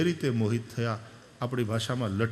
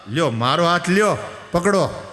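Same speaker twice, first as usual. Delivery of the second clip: louder, offering hand; calling out; 3 semitones higher, 8 dB louder than usual. Retold or imitated. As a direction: call down to take my hand. (મોટેથી હાથ આપતા)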